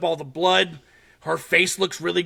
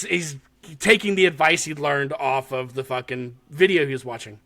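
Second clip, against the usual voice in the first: ordinary delivery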